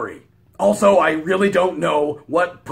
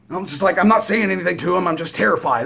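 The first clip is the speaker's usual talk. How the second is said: plain reading voice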